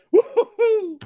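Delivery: high, loud laughing woo-hoo; a burst of laughing joy at the good years; joyful whoop. Real, not acted. Joy of the last fifteen years.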